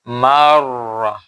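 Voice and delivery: মাররা with doubled r said heavy and drawn out; slow tajweed demo of মাররা; heavy r recitation. Shown as a demonstration. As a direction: stretch মাররা slowly with full emphatic r. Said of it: "রায়ের উপর তাসদিদ থাকলে".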